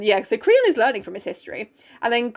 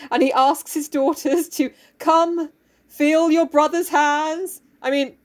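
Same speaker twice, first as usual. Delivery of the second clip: normal talking voice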